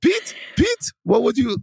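high, squeaky put-on Dutch accent; mock Dutch accent voice. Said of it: "doing the Netherlands voice".

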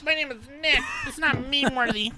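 high, loud mocking whine; mocking whiny voice. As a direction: mimic him in a shrill whine. Mocking Nick, far high shout.

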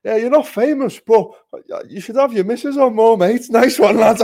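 high, loud heckle from a passer-by; high hollering voice, breaking into a laugh at the end; imitated shout across road. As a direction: yell it over the road as a cheeky stranger. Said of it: "guy shouts from across the road".

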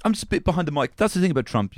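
high-pitched comedian impression; attempted Trevor Noah voice. Co-host playing the invited guest, attempted Trevor Noah voice. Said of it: "I can't do the voice".

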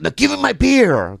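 high-pitched mock demand; angry demand. Customer demanding beer from clerk, mock angry. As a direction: imitate an indignant customer demanding.

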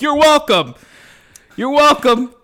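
loud, high, self-congratulating boomer act; mock smug narcissism. Mocking a smug sitcom boomer, high and loud mock boast. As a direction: crow it like you expect thanks. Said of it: "the stereotypical narcissism that we".

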